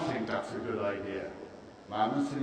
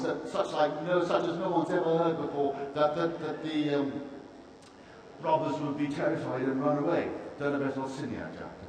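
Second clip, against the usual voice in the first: usual speaking voice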